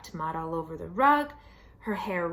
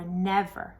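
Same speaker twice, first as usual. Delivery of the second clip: usual speaking voice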